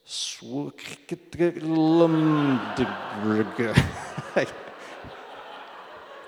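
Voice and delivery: long, laboured string of jumbled letter sounds; mock a child forcing gibberish into a word; struggling to sound out. Dad imitating son reading the eye chart, straining. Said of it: "somehow make a word out of that jumble".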